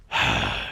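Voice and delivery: weary sigh out; sigh, fed up; heavy sigh. Real, not acted. Forgot the signs again.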